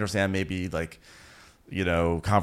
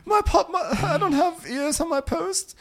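very high squeaky parody wail; hammed-up crybaby squeal; mock tearful confusion. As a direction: stammer it in a put-on weepy falsetto. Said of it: "so she cries".

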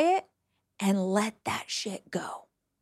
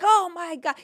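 amazed gasp; 7 semitones higher than usual. Retold or imitated. Neighbor's amazed reaction.

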